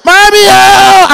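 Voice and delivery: loud, slow high sob; drawn-out crying wail. Retold or imitated. Child wailing to his mother after the beating. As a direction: wail it out long.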